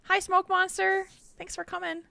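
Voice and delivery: ordinary delivery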